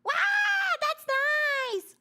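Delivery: high, loud cutesy squeal; imitating Henya. Streamer showing how a cute collab partner talks, high squealed imitation. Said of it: "if Henya's like".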